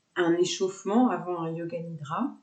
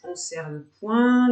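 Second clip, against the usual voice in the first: usual speaking voice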